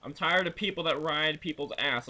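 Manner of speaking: ordinary talk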